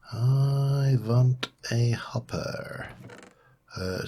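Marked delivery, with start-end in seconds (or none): 0.1-2.9 put-on slow hammy vampire accent, v for w; drawn-out mock Dracula accent; fake vampire accent. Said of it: "stop pronouncing this with a fake vampire accent".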